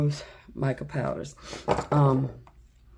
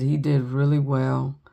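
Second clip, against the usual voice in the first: ordinary talk, nothing marked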